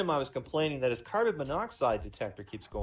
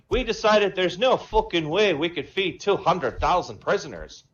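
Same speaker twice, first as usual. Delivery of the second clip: higher, louder Irish brogue; Irish accent; 5 semitones higher, 6 dB louder than usual. Acted. Paddy the Irishman phoning the president, Irish accent. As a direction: play a cocky Irish pub man. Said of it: "Irish and Jamaican".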